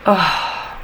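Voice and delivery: ordinary talk, nothing marked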